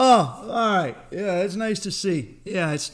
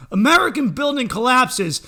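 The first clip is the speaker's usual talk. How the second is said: mock headline read; 6 semitones higher, 4 dB louder than usual. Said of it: "exactly how I would title the article".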